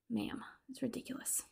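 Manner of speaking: sigh, then a soft, fed-up "Ma'am"; exasperated whispered ma'am. Real, not acted. Viewer despairing at the movie heroine, sighing whisper. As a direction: breathe "Ma'am" like you've given up on her.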